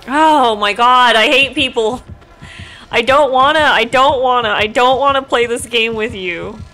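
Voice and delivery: loud, slowed, exasperated venting; groaning out real frustration at the game; frustrated outburst. Real, not acted. Gamer fed up with a tough opponent, loud frustrated venting.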